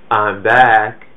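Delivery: half-hearted attempt at an Austrian accent; poorly imitated Austrian action-star line; Schwarzenegger. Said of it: "very horrible impression of him".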